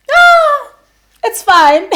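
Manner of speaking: very high, loud squeal into it's fine; a real, shrill squeak of distress; weird squeal. Real, not acted. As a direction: squeal it out, then brush it off. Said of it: "I need to stop making these weird sounds".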